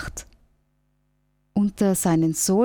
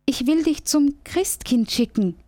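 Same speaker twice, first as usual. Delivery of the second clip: higher, chirpy little squirrel; 6 semitones higher than usual. Acted. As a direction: voice the squirrel high and eager. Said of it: "sagt das Eichhörnchen".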